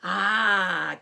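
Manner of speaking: loud breathy ah after a drink; young fox catching breath after cider; gasp. Acted. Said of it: "gasped the little smallest fox".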